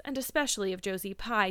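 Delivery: ordinary delivery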